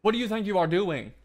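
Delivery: high, loud challenge; real outraged shout; indignant shout. Real, not acted. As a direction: yell it at the boss. Confronts a boss.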